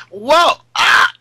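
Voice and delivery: sudden high, loud yelp of fright; startled yelp. Retold or imitated. Retelling the cat jumping on her arm mid-interview, a high loud startled yelp. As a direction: jump out of your skin as the cat lands. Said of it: "and I was like".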